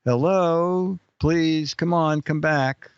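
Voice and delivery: ordinary talk, nothing marked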